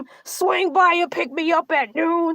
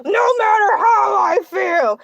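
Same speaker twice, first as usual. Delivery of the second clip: mock rallying shout; 3 semitones higher than usual. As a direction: belt it out like a stubborn motivational speech. Hammed-up determined declaration.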